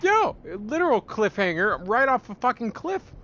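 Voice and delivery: high, loud ranting yell; furious disbelief. Real, not acted. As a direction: shout your disbelief at the ending. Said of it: "I was so pissed".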